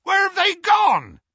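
high, loud shouted question; alarmed holler to the one behind; bellowed. A narrator's reading. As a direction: bellow it urgently as Hagrid. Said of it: "bellowed Hagrid".

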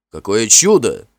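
loud, joyful cry; Finn's loud cry of joy at her love; joyful exclamation. A narrator's reading. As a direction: burst out in delight. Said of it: "радостно воскликнул Финн".

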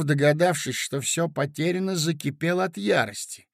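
ordinary delivery, nothing marked

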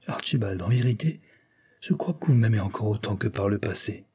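ordinary delivery

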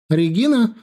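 high, loud shout of a name; calling out. Acted. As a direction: call her daughter urgently. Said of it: "тут же закричала Нина Георгиевна".

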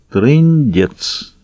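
resigned, mildly gloomy thought; melancholy. A narrator's reading. Baron thinks over looming civil strife, melancholy. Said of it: "меланхолично подумал барон".

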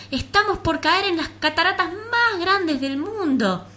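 high, loud, slow alarm; panicked warning. Acted. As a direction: warn them in a panic. Flea warns of waterfall.

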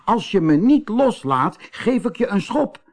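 high, acted indignation; cross threat. A narrator's reading. Kees stuck to the doll, high cross threat.

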